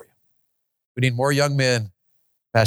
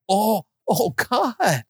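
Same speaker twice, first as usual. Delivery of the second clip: higher pleading cry to God; fervent cry; 6 semitones higher, much slower than usual. Shown as a demonstration. Preacher showing how fervency sounds, pleading. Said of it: "What does fervency even sound like?".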